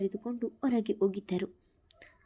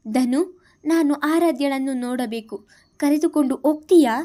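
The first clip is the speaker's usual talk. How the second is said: rising, louder urgent plea; anxious pleading; 4 semitones higher, 6 dB louder than usual. Acted. Wife begging her brother to see her baby, anxious plea. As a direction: beg him to take you there, quickening at the end.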